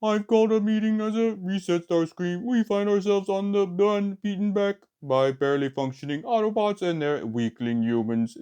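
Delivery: high, loud villain robot speech; high robot villain addressing the Decepticons; cartoon robot voice. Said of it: "I hear his cartoon voice".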